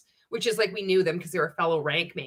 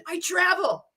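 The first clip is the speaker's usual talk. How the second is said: higher sneering echo of his brag; mocking Ray; 10 semitones higher than usual. Mocking the boss's brag back at him, higher and sneering. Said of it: "I'm like".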